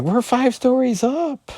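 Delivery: high-pitched mock panic; alarmed woman. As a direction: squeak it out as an alarmed female character. Megan alarmed at being jumped down.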